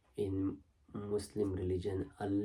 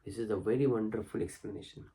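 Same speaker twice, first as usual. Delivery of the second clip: ordinary talk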